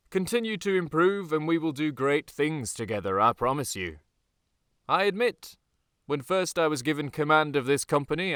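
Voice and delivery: loud, high commanding address; rally the troops, proud and stern; officer haranguing his company at dawn; rousing speech. Acted.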